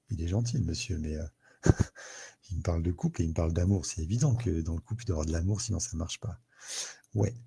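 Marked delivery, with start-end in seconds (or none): 0.1-3.8 mock skeptical listener. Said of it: "Alors, vous dites".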